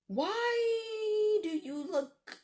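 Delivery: slow, high crying shout, then a sob; frightened cry. Retold or imitated. Alone in the hallway, staring at the bowl: frightened crying shout. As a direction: cry out in fear at what she sees. Seeing the strange salt water bowl.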